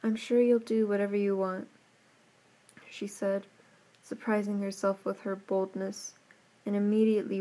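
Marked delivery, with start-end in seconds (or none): none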